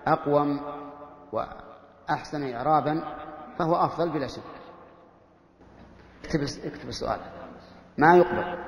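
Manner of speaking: ordinary talk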